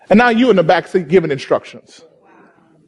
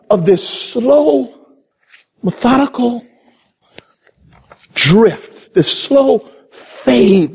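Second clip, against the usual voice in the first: drawn-out slow; 3 semitones higher, slower than usual. Real, not acted. Drawn-out words about slow fade.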